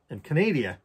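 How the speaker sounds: put-on playful made-up Canadian accent, "ca-NAY-dee-a"; mock Canadian accent. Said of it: "what the fuck that accent is".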